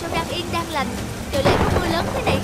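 frightened traders calling out as the boat rocks; panicked cries in storm. Acted. Trader panicking over cargo in sudden storm, high frightened cries.

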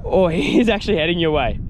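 ordinary delivery

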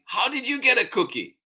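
put-on high, fast child's question; demanding child. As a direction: blurt it out high and quick. Charlie demands, first reading.

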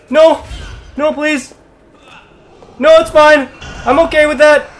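high, loud anxious yelps; tense gamer babble, pitched well up; nervous pleading. Real, not acted. Panicked pleading during boss fight.

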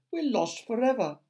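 high-pitched cry of doom; despairing shout. A narrator's reading. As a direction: cry out hopelessly. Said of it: "shouted the ladybird".

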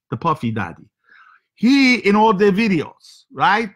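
comic foreign host with broken English; heavy comic accent, broken grammar. Acted. Comic foreign character Sergey hosting show.

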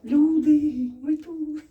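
high, drawn-out call; calling for help. Real, not acted. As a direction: call out so someone outside hears.

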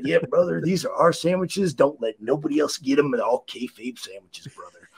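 low gravelly wrestler rasp; Macho Man voice. Retold or imitated.

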